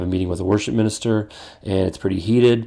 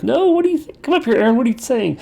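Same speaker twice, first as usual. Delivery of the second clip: high, mock-shrill wife calling from upstairs; a husband retelling his wife's high call from upstairs; wife yelling down. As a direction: play the exasperated wife shouting down the stairs. My wife will yell down from upstairs.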